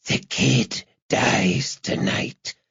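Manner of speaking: slow, hissed threat; menacing hiss. A narrator's reading. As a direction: hiss it slowly down the line. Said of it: "hissed a voice on the other end".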